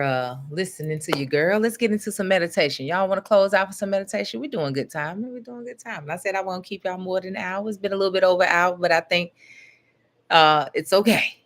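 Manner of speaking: ordinary talk, nothing marked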